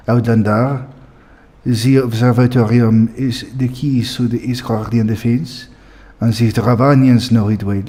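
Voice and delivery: game character voiced in French accent. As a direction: try out a newly learnt French accent. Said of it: "Let's go try this out".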